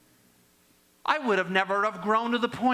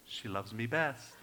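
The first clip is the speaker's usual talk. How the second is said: put-on a soft, quiet boast to the baby; softly gloating to the grandbaby; hushed brag; 8 semitones lower, 9 dB quieter than usual. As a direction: murmur the brag like a secret. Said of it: "It's a brag moment".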